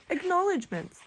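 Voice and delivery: high, stunned reading of the word; shocked. Retold or imitated. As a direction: blurt it out in disbelief. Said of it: "and it goes".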